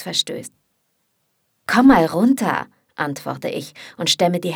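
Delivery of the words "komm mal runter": retort. Acted. Caro retorts to angry Markus.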